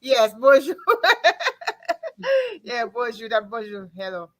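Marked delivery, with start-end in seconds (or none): 0.0-0.8 bonjour through a laugh, louder. Real, not acted. Host laughing over a Patois greeting, laughing. 0.8-2.6 a high, delighted laugh; high burst of laughter. Real, not acted. Host amused at her own slip, high laughter.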